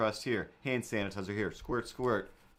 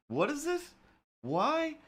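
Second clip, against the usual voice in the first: what is this, pitched very high; a baffled cartoon squeak; squeaky confused voice; slower than usual. Voicing a baffled figure while drawing, squeaky high.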